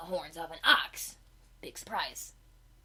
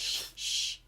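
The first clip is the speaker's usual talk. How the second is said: shushing. Real, not acted. Hushing the other.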